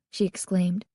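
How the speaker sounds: usual speaking voice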